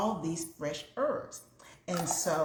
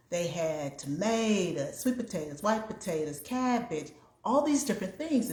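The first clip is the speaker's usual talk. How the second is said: ordinary talk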